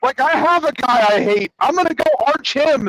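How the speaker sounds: high, worked-up mock griping; indignant villain. Villain objecting to assigned nemeses, indignant. Villain argues against the rules.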